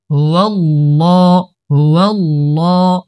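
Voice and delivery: loud হুয়াল্লাহ with a heavy, dark l; thick lam. Shown as a demonstration. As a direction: say the double l of হুয়াল্লাহ full and thick. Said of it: "আল্লাহ শব্দের লাম মোটা". (হুয়াল্লাহ-র লাম মোটা করে)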